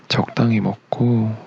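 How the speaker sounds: ordinary talk, nothing marked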